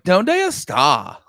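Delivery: mock a heavy American accent in Spanish; high, drawn-out Spanish with an American accent.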